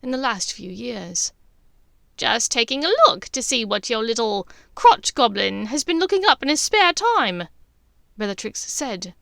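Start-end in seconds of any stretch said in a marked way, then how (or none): 2.2-7.5 loud, sneering jibe. A narrator's reading. Bellatrix sneering at her sister's son. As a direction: spit it out with contempt. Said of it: "with a sneer".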